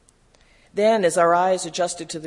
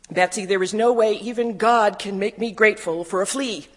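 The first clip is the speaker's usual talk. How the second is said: loud, higher-pitched refusal; exasperated protest; 4 semitones higher, 6 dB louder than usual. A narrator's reading. Woman refusing her sister's thanks for fleas, exasperated protest. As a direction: object forcefully, louder all through. Protest after this was too much.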